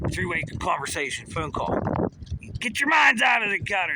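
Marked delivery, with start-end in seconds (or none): 2.6-3.9 loud, high mock-scolding yell; raised-voice telling off; scolding shout. Real, not acted. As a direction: shout it at the audience to shame them. Scolding viewers for dirty minds.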